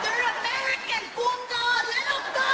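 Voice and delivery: squeaky mocking heckle out a car window; passersby yelling. Drivers passing the fake arrest, high falsetto mock yell. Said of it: "People are driving by going".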